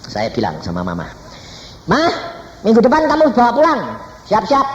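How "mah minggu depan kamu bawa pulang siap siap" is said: raised-voice heads-up; urgent calling voice, imitated; retold loud announcement. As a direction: call out to mother, high and loud. Retold announcement called out to mother.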